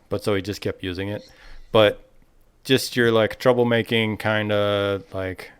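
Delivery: ordinary delivery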